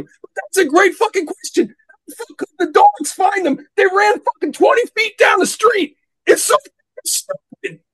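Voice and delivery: high-pitched, worked-up exasperation; comically livid movie tirade; furious rant. Real, not acted. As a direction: rant until the voice climbs and cracks with outrage. Climax of angry rant at movie.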